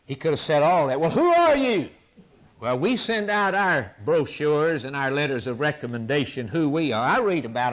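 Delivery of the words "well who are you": high, loud demanding question; questioners demanding. Acted. Priests questioning John the Baptist, high demanding shout. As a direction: press him for an answer. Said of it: "They came to John the Baptist and said".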